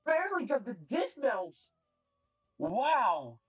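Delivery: high-pitched, thrilled exclamations; amazed commentary. Real, not acted. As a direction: burst out in real amazement at the play. Live commentary on a narrow success.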